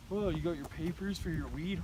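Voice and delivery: ordinary talk, nothing marked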